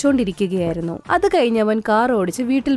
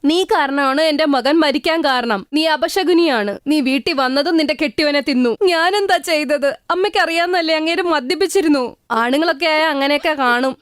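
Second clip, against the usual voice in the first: higher-pitched cruel mother-in-law blaming the bride; accusing mother-in-law; 4 semitones higher than usual. Acted. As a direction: spit out the blame like a bitter mother-in-law. Mother-in-law blames widow.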